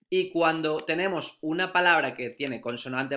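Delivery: usual speaking voice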